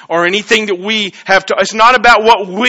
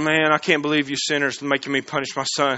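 mock grumbling God; 4 semitones lower, 9 dB quieter, faster than usual. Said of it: "It wasn't like God was going".

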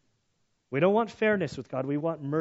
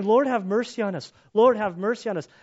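loud pleading cry, repeated; loud begging; 6 semitones higher, 7 dB louder than usual. Retold or imitated. Blind beggars crying out to Jesus, loud begging. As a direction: beg aloud for mercy, louder each time. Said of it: "They're saying".

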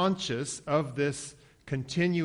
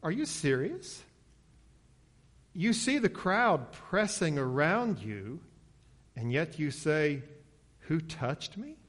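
incredulous; 3 semitones higher than usual. Acted. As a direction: question it as if it's absurd. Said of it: "I can just imagine their tone of voice is like".

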